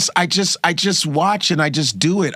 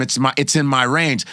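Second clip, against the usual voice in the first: ordinary talk, nothing marked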